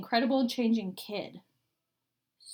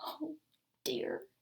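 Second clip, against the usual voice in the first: soft, sighing disappointment; sadly; 6 semitones higher, 9 dB quieter than usual. Acted. Old woman disappointed in the girl, soft and sighing. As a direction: let them down gently. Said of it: "the old woman said sadly".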